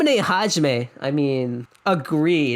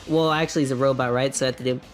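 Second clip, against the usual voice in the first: ordinary delivery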